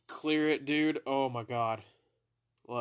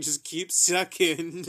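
slow and laughing through it; 5 semitones higher, 8 dB louder, slower than usual. Retold or imitated. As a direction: giggle the words out slowly. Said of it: "she started laughing exactly like that too".

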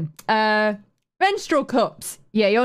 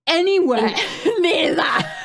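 breaking into laughter, voice going high; real high-pitched laughing protest; laughing refusal; 8 semitones higher than usual. Real, not acted. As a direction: laugh helplessly through the words. Laughs while refusing the idea.

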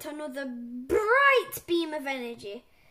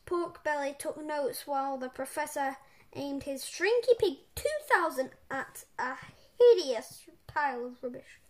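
usual speaking voice

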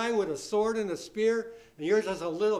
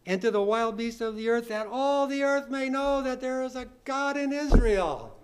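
sustained, emphatic Bible reading, rising at the close; declaimed scripture reading; 3 semitones higher, 4 dB louder than usual. Real, not acted. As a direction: build the verse into a proclamation. Reads David's challenge from scripture.

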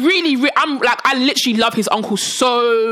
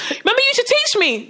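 high-pitched imitation of an old acquaintance; mock an eager reminder, pitched up high; 10 semitones higher than usual. Voicing person she has forgotten.

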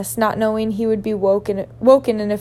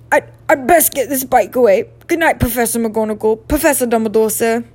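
loud, stammered, lightly muffled goodbye; 5 dB louder than usual. A narrator's reading. Giant bids the professors good night, muffled. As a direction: say goodnight through a slightly muffled voice. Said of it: "said Hagrid in a muffled voice".

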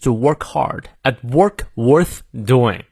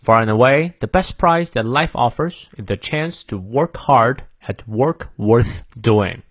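slow American accent, r's sounded; careful American model reading, key words stretched; American pronunciation; slower than usual. Shown as a demonstration. Teacher reads it once more for learners, American accent. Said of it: "美式发音，我们来念两遍".

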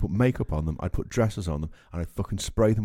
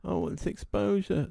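high, slow sneering parody of a hopeful; lightly voiced sneer at a wannabe; mock whiny excuse; 10 semitones higher, 5 dB quieter, slower than usual. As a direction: mimic a naive contestant making excuses. Mocking a talent show hopeful.

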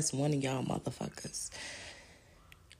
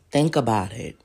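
low emphatic; 8 semitones lower, 5 dB louder than usual. Shown as a demonstration. Urging viewers to think, Think about it low and firm. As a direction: Think about it said much lower and louder.

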